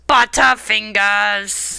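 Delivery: slow, dragged-out zombie groan. Shown as a demonstration. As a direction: stretch the word out like the undead. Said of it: "to say in a zombie-like voice".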